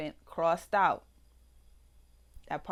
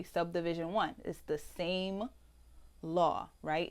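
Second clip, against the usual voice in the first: ordinary delivery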